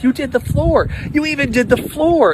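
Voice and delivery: high, excited exclamation; the woman thrilled the floor was done; a high burst of happy surprise; delighted disbelief. Retold or imitated.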